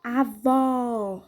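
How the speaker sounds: AVÓ with a long, wide-open ó; open vowel demo. Shown as a demonstration. Teacher repeats the example for pupils, drawn-out open AVÓ. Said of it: "eu abro mais a boca".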